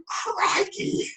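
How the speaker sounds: very high-pitched playful exclamation; mock shock. Shown as a demonstration. Author playing with favorite Aussie phrase, mock shock. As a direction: squeal it in mock amazement.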